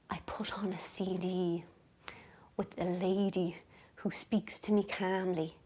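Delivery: soft, slow telling with long pauses; hushed, calm. Real, not acted. Telling viewers about her bedtime CD, hushed and calm. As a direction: ease down into a slow, soothing hush. Describing her calming sleep CD.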